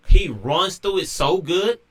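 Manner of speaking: ordinary delivery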